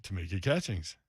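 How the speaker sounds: low, soft repeat of the name; deadpan echo. Real, not acted. Host echoing the co-host's pick, low deadpan. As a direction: repeat it low and under your breath.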